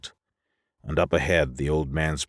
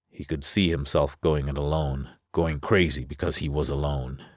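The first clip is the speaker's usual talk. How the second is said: usual speaking voice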